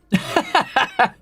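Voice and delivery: high chuckling laugh; laughter. Real, not acted. Amused at being called an old man ranting, laughing. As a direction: laugh in a quick high run.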